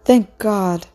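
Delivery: lightly acted sobbing relief; high, falling cry of relief; sobbed. A narrator's reading. Sunny feels Orlu's heartbeat, mildly tearful high relief. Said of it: "she sobbed".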